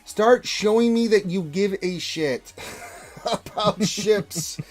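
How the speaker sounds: loud, high, slow exasperated demand; emphatic demand. Real, not acted. Player venting at developers over ships, emphatic and exasperated. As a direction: drag out each word, pushed high and loud.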